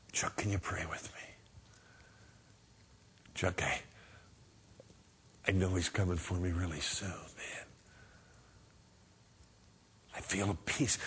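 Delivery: very low, hushed, near-whisper; dying believer. Acted. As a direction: speak faintly from a deathbed.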